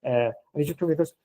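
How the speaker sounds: ordinary talk, nothing marked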